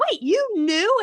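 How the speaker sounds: high, loud mock astonishment; retold shocked disbelief. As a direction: imitate someone stunned by a revelation. Hermione shocked at Harry, retold.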